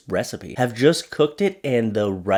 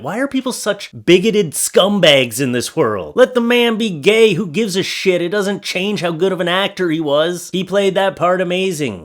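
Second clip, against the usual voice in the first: angry rant; 5 semitones higher than usual. Real, not acted. Ranting at a bigoted commenter, rising angry outrage. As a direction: let real anger spill over. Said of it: "I'm getting a little angry".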